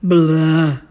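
loud, grumbled dismissal; grumpy muffled sleeper. A narrator's reading. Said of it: "said a voice from inside the house".

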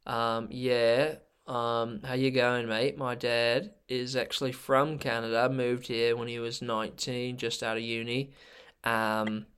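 put-on laid-back Australian snowboarder accent. Snowboarder introducing themself to podcast listeners, Australian accent. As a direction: put on an easygoing Aussie accent. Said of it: "I would like you to do your Australian".

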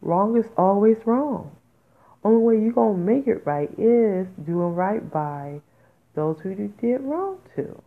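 worked up. Real, not acted. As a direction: get worked up and press the point hard. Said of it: "I have to calm down".

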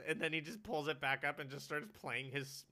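ordinary talk

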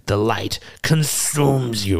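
slow, solemn battle proclamation; intense holy warrior. Acted. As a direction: pronounce doom on the creature slowly. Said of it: "she just runs forward and she says".

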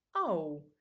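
sudden loud "ow"; pained yelp. A narrator's reading. Someone hit in the dark cries out, pained yelp. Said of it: "jajdult fel valaki a sötétben".

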